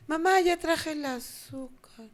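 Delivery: put-on a little girl calling out, then losing hope; imitating lost child. Child in the supermarket calling her mother, slow and fading.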